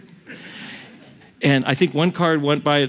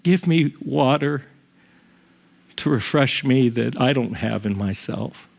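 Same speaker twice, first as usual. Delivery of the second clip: lower, softer, earnest prayer to God; quiet demo prayer; 5 semitones lower than usual. Shown as a demonstration. Pastor modelling a prayer for the congregation, soft and humble. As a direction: pray it humbly, almost under your breath. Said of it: "just pray".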